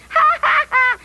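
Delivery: high, mocking ha-ha-ha; demonstrated laugh. Shown as a demonstration. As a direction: show off an exaggerated laugh. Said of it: "this is a laugh".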